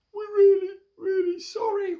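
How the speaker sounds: very high, crying mouse apology; sorry mice. Acted. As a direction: mice wailing their apology. Mice confess to the cats.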